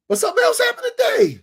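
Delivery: sudden high, loud shout; excited shout. Real, not acted. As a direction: burst out with the big news. Excited announcement of new news.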